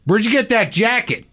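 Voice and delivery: high mocking American heckler; heckler American accent. Comic retelling heckler, high American jeer. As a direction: call it out like a rude heckler. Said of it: "a guy with an American accent goes".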